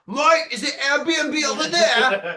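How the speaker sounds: high singsong Australian tourist voice, half laughing; mock Australian tourist. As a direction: play the lost Australian tourist begging for directions. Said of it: "and I'll be like".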